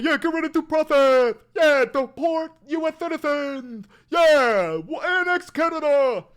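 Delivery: mocking MAGA supporters to chat with a dumb voice. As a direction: ham up a brain-dead supporter. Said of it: "So when they advocate, well".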